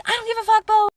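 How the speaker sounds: high-pitched dismissive sister imitation; mock sisterly backtalk; mocking bad attitude. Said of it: "she had the worst attitude ever".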